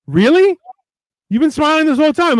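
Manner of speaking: high-pitched mock disbelief; incredulous friend. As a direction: imitate a friend who can't believe it, voice jumping up. Friends' disbelieving reply, acted.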